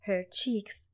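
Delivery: usual speaking voice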